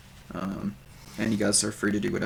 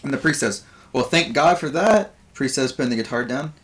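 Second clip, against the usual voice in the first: raised, louder comic bard voice; bard doing stand-up; 5 semitones higher, 4 dB louder than usual. Acted. Game master voices the bard Buvor greeting the audience, higher and louder. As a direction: Buvor working the crowd as a nervous comedian. Bard telling a flood joke.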